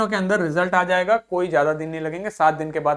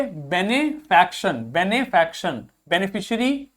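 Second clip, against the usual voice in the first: बेनिफैक्शन slowly, be-ni-fak-shun; slow pronunciation drill; slower than usual. Shown as a demonstration. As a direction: model बेनिफैक्शन slowly for the class. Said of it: "इसको क्या पढ़ेंगे". (बेनिफैक्शन धीरे-धीरे)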